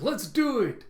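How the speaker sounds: high, loud rallying yell; enthusiastic cheer. Retold or imitated. Crew agreeing to Frankie's toast, enthusiastic cheer. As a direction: jump in with gusto.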